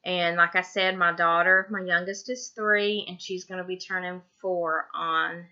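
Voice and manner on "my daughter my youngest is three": ordinary delivery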